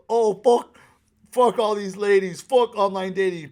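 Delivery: loud, high pretend rant; mock bitter outburst. Imagined swearing at online dating, mock angry outburst. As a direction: play the bitter dater you refuse to be.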